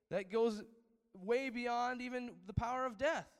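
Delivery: building sermon proclamation; preaching loudly. Real, not acted. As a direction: drive home the power of faith. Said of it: "preaching climax".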